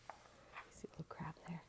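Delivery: whispered so as not to scare them; don't scare the crabs, just a whisper. Shown as a demonstration. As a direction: breathe it like a secret. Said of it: "whisper repeat".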